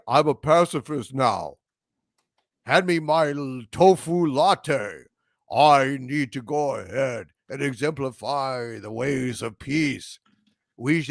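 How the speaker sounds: mock Worf. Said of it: "they have Worf saying".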